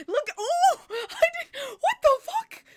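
high, loud startled outburst; shocked exclamation. Real, not acted. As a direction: caught completely off guard. Suddenly spots something on screen.

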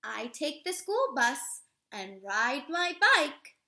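slow, expressive storybook read-aloud; reading to children. Acted. Teacher reading a picture book to children, expressive read-aloud. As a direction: read it warmly to a class of little kids.

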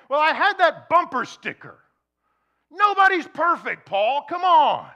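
high, loud whiny protest at Paul; mock protesting listener. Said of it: "would say".

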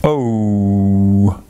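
"O" drawn out on one low, steady note; long thin O. Acted. Daddy showing how the O noise runs out, long "O". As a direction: hold "O" long and let it run out thin. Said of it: "how the O noise runs out all thin".

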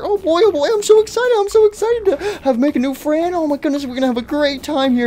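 high, loud, giddy caretaker squeal; excited daycare attendant. Acted. Attendant invites new friend to a slumber party, squealing. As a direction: build into a thrilled squeal of delight.

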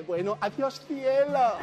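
sweet goodbye. Acted. Soldier answers sweetly.

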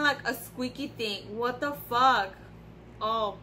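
normal talking voice

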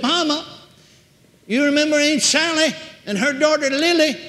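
high, loud, insistent explaining; calling to get through. Retold or imitated. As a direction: raise your voice to reach someone barely conscious. Daughter trying to reach comatose mother.

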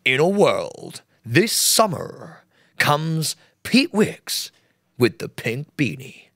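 very slow, dramatic trailer-announcer read; movie trailer voice. Pretend. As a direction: intone it like an epic film trailer. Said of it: "So, like, we make trailers".

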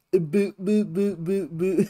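imitating a silly high boo chant; mock boo chant. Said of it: "The way he goes".

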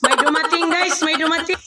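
high, loud, eager calling out; excited announcement. Real, not acted. Visitor arrives mid cooking.

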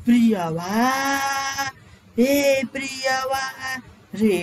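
slow calling with the last vowel dragged long; stretched calling out. Shown as a demonstration. Teacher demonstrating pluta by calling a child, drawn out. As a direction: call out पपुआ, pulling the end out very long. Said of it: "last में बोलते हैं खींच खींच के".

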